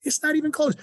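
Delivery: high-pitched, incredulous rant; worked-up, high-pitched outburst; ranting disbelief. Real, not acted. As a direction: protest it high, as if it were absurd. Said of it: "sorry to rant".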